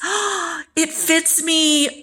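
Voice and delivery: high-pitched gushing delight, starting on a gasp; hammed-up delighted squeal; thrilled relative. Relative thrilled that bracelet fits.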